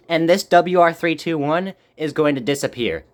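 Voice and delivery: ordinary talk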